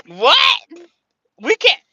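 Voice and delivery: high, loud indignant what; indignant disbelief. Real, not acted. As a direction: sound scandalized. Outraged at critics.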